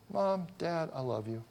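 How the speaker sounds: soft and simple, starting a little high; quiet, sincere affection; tender child's declaration. Retold or imitated. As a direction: imitate a kid's heartfelt words, gently hushed. Voices child speaking to parents.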